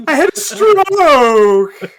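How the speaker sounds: slow, loud, high strangled cry; Simpsons quote voice. Pretend. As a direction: drag it out like a cartoon goof. Simpsons quote after odd voice.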